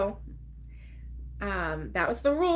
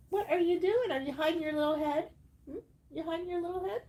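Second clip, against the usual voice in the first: real doting high-pitched coo; cooing pet talk; 7 semitones higher, 4 dB quieter, faster than usual. Real, not acted. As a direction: tease a hiding pet fondly. Talks to her hiding pet.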